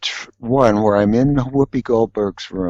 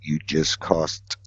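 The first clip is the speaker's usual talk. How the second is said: much softer and slow, dry; quiet slow reproach; 3 semitones lower, 10 dB quieter, slower than usual. Retold or imitated. Phil turning to him with a low, slow rebuke. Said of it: "Phil turns to me and says".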